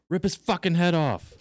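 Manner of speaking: loud, high football-crowd yell; bloodthirsty heckler's shout. Acted. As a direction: yell it like a fan in the stands. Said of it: "you yell out".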